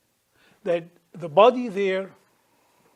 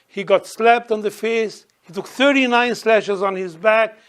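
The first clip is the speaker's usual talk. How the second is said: normal talking voice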